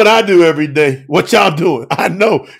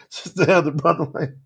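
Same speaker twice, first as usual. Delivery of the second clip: ordinary talk, nothing marked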